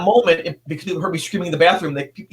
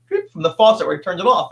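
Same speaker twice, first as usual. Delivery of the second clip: normal talking voice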